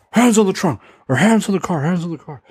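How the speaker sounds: high, urgent mock cop command; barked police order. Serious cops ordering teens against the car, mock-urgent. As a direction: imitate an overwrought cop giving orders.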